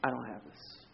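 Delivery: soft, deflated admission; a small, beaten-down confession; quiet defeat. Retold or imitated. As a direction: let the confidence collapse into a near whisper. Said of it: "You come to this place".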